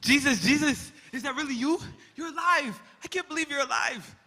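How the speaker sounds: high, loud cries of joyful disbelief; overjoyed. Acted. Peter seeing risen Jesus, ecstatic cries. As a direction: shout it as if he can't believe his eyes.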